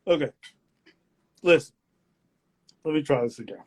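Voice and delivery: ordinary delivery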